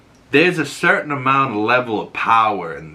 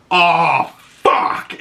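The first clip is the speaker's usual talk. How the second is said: higher, louder sudden curse; sudden outburst; 6 semitones higher, 3 dB louder than usual. Retold or imitated. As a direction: imitate a delayed realization, drawn out. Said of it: "all of a sudden he goes".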